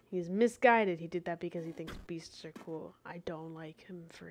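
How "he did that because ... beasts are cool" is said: ordinary delivery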